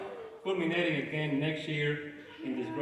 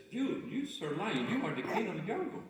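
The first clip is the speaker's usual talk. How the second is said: humble zebra; 6 semitones higher than usual. Acted. Humble zebra voiced in joke.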